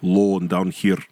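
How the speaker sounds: low Russian voice demo. Shown as a demonstration. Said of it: "Russian is very low".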